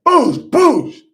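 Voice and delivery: loud, high mouth-made gunshot booms; gunshot noises. Retold or imitated. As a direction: imitate two pistol shots. Imitating gunshots again.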